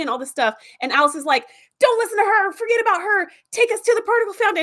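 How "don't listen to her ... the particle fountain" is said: much higher and louder, insistent; pushy devil ship. Retold or imitated. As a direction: egg him on like the devil on his shoulder. Devil ship pushing Tom.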